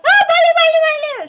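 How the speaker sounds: very high, loud urging; mock shriek to hurry up; hurrying ajumma. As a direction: imitate a frantic ajumma rushing everyone. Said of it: "And she's like".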